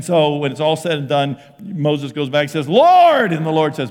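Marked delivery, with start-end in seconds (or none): 2.7-3.3 loud, high drawn cry to heaven; hammed-up call for help; anguished call. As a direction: wail it as an exasperated plea. Moses crying out to God, acted.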